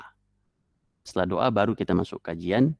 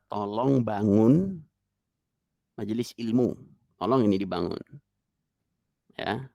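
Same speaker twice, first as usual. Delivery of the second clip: ordinary delivery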